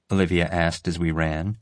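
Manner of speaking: ordinary delivery, nothing marked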